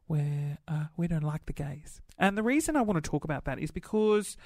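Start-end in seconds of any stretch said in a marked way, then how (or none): 0.0-2.0 religious self. Pretend. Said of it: "slip into my religious self".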